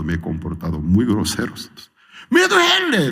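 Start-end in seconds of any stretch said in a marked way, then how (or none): 2.3-3.1 pained cry. Acted. Voicing pain.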